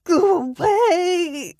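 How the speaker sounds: high, slow, loud crying scream; anguished drawn-out scream. A narrator's reading. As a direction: scream it to the sky, near tears. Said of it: "and screamed".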